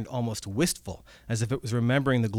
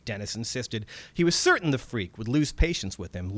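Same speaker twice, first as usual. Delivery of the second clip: plain reading voice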